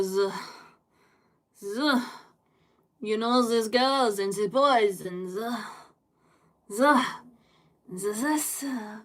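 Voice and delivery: French accent impression, buzzing z sounds for "th"; thick mock French accent; French boss. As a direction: imitate a French boss stuck on "ze". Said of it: "I used to have a French boss".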